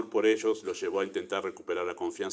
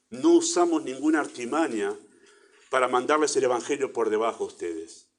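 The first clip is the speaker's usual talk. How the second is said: ordinary delivery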